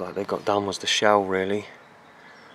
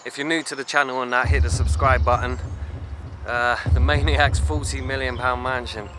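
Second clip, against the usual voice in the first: louder, punchy channel sign-off; vlog outro; 3 semitones higher, 6 dB louder than usual. Real, not acted. Presenter signing off to his channel viewers, punchy outro. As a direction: pump up the viewers as you wrap up.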